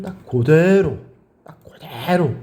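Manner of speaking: 그대로 with a long drawn-out, high 대; emphatic stretch. Real, not acted. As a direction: hammer home 그대로, stretching its middle syllable. Teacher insists on exact memorizing. ('그대로'의 '대'를 높고 길게 늘여 강조)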